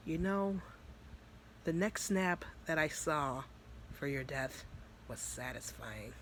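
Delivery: slow, soft taunt, sinking away; quietly menacing demon. Acted. Demon Vice taunting Natsuki about her death, quietly menacing. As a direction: savour the cruelty slowly and softly.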